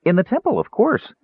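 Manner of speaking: high, breezy obviousness; matter-of-fact. A narrator's reading. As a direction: answer as if it were utterly ordinary. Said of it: "as if it were the most common thing".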